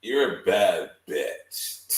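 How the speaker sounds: drawn out very slowly, a hint of sass; slow sassy quote. Mocking the rapper's line to friends, slow and sassy. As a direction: savor each word like a diva. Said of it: "he ain't sound sassy when he said that".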